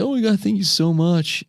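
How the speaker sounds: high, overjoyed gushing; mock-overjoyed thank you. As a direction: gush your thanks like a delighted stranger. Homeless guy thanked, acted quote.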